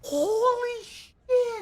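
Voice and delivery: high, stretched-out shriek of disbelief; excited shriek. Real, not acted. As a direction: squeal it out, overwhelmed. Streamer overwhelmed by gifted subs.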